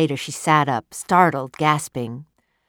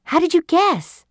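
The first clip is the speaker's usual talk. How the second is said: much higher, eager and delighted; light up with excitement; wife thrilled that he guessed; excited; 13 semitones higher, 5 dB louder than usual. Acted. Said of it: "She was excited".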